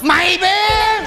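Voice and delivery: high, drawn-out stage shout; angry shout. Acted. Father confronts the nanny, yelling long and high. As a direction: stretch it into a long hammed-up yell.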